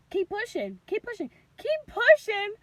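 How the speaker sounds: high voice, building to loud; urging staff. Retold or imitated. Staff urging her, retold.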